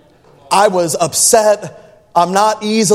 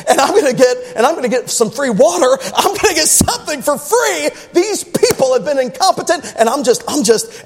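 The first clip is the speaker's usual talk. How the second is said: gloating plan; 5 semitones higher than usual. Retold or imitated. Gloating inner monologue, scheming.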